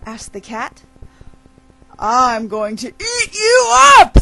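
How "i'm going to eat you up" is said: high, loud predator's threat; dog crowing a threat at its prey; menacing gleeful shout. Acted. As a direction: swell into a loud pounce. Dog threatens to eat the cat.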